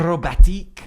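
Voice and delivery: acrobatics said the French way, Acrobatique; hammed-up French pronunciation of Acrobatique; mock French.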